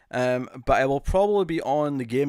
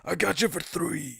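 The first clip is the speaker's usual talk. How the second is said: Macho Man line; 4 dB quieter, slower than usual. Retold or imitated. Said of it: "strange Macho Man".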